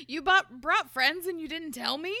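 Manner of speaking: lightly voiced outraged heroine; Tessa voice. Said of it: "She's like".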